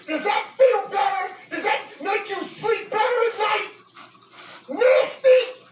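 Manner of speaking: high indignant questions building to a shout; angry taunt. Real, not acted. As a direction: press him, then explode on the last word.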